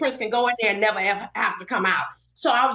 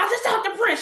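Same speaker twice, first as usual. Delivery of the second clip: screaming and hollering; 11 semitones higher, faster than usual. Retold or imitated. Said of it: "I'm screaming and hollering".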